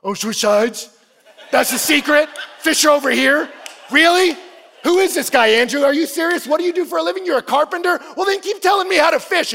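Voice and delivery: loud, high, mocking disbelief; frustrated sarcasm. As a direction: shout sarcastic questions at the stranger. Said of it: "And he's just so frustrated".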